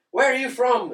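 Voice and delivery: lightly voiced drunk Irishman questioning someone; drunk Irish guy. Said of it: "there was a drunk Irish guy running around".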